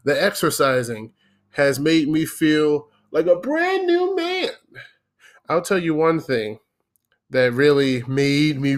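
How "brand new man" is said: announcer voice. Acted. Joking about feeling young.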